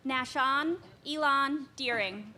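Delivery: projecting names across the hall; firm carrying classroom voice; teacher voice. Real, not acted. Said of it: "I have to use my teacher voice".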